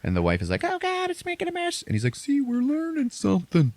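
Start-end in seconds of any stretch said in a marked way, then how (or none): 0.7-1.8 high squeaky fussing wife; mock fussy wife. Wife upset at the mess, squeaky mock complaint. 2.2-3.7 squeaky proud dad; high mock dad. Dad defending the demo to his wife, high mock pride.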